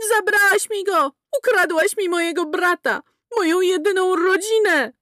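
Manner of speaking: high, loud accusing cry; enraged sister-in-law shouting; furious accusation. A narrator's reading. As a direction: scream it as you charge. Mildred charges screaming accusation.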